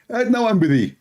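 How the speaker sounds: high mock voice of a dodging debater; mocking Zakir Naik. Mocking the debater who keeps dodging, high and dismissive.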